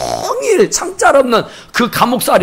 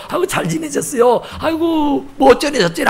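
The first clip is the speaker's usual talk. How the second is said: lightly mocked social gushing, pitched up; lip-service greeting; 7 semitones higher than usual. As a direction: imitate effusive small talk. 립서비스 인사를 흉내 냄. (높은 톤으로 립서비스 인사 흉내)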